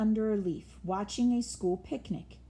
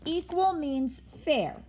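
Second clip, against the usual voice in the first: explaining; 8 semitones higher, 4 dB louder than usual. A narrator's reading. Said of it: "explained Mouse".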